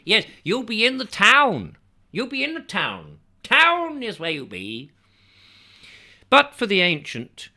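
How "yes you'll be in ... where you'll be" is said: high rural local, town stressed; rustic dialect. Acted. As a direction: village voice leaning on town. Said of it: "Emphasis being on the last word".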